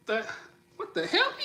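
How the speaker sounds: high, stunned self-check; mock disbelief. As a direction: squeak it out in shock. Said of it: "look at my skin like".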